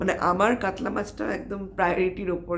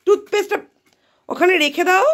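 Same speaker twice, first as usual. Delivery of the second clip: much higher and louder, pleading scold; high loud mom telling off; exasperated scolding; 10 semitones higher, 8 dB louder than usual. Real, not acted. Telling child to put toothpaste down.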